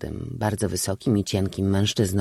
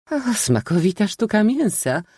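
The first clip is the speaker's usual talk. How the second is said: high mocking lady's voice; sarcastic old lady; 12 semitones higher, 3 dB louder than usual. A narrator's reading. Eccentric aunt mocking the parasite, high sarcastic voice. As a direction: sneer at the dish, high.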